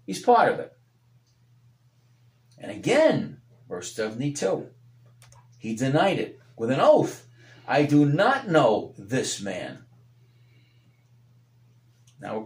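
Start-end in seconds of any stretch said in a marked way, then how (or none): 0.1-9.8 fervent preacher, rising and falling; preaching. Acted. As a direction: preach it out to the congregation. Preaching at full force.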